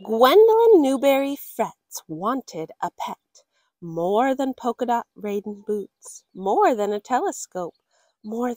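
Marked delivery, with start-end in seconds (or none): none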